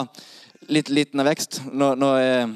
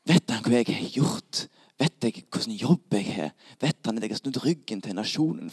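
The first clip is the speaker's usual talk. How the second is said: anxious inner thoughts; 3 semitones lower, 5 dB quieter than usual. Acted. Zacchaeus wondering if Jesus knows him, hushed and low. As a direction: think aloud softly, question by question. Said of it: "Zacchaeus tenker".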